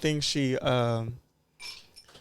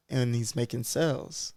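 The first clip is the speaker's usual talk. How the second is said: usual speaking voice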